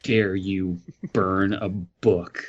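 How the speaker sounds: ordinary delivery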